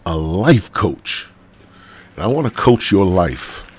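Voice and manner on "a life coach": slow emphatic. Real, not acted.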